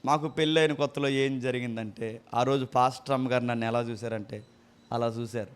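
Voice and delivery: mocking a preacher's pulpit gossip. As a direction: imitate a preacher's idle anecdote, low and soft. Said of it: "ఏం చెప్తారు చెప్పనా?".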